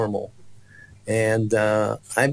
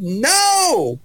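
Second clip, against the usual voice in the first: high loud defiant scream; 14 semitones higher, 4 dB louder than usual. Retold or imitated. The boy refusing a second time, loud high scream. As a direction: shriek it again in refusal. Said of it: "the second time I said".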